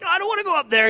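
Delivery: high, loud whiny protest; mock reluctant whine. Pastor reluctant to be called forward, high loud whine. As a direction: whine like a kid who won't go. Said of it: "I was like".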